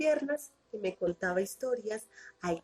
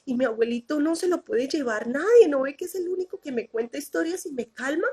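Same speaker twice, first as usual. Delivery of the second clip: niña asustada; 7 semitones higher than usual. Retold or imitated. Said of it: "yo me asusté".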